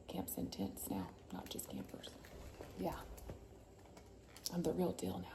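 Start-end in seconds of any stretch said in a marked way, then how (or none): 0.1-2.1 whispered aside, voice dropped to a hush; hushed confiding whisper. Real, not acted. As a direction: lean in and whisper it like a secret. Aside whisper about mom.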